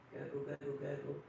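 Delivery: Santa's belly gurgling, low; low gurgle. Acted. Imitating tummy noise.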